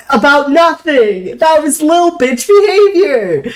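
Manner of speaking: laughing. Retold or imitated. Laughing while quoting self.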